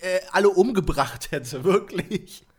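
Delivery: laughing through the words, a bit slow; real laughter breaking in. Real, not acted. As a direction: giggle while telling it. Laughing at own story.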